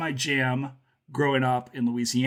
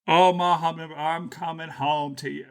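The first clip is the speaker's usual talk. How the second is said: hammed-up Southern accent, higher on the first words; Nick Cage; 4 semitones higher than usual. Podcaster mocking Cage's line to co-host, Southern accent impression. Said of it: "it's when he says butterfly or hummingbird".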